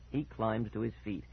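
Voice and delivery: usual speaking voice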